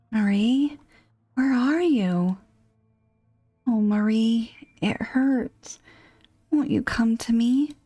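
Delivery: wounded husband calling his wife; hurting husband calling. Acted. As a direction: call for her through the pain.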